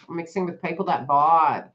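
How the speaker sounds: ordinary talk, nothing marked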